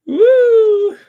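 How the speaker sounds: high, loud gleeful whoop. Real, not acted. Relishing the Sussex titles, loud whoop. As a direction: let out a piercing woo.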